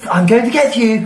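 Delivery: high cartoon dragon, laughing through it. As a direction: play a goofy cartoon villain dragon. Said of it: "That's what I think".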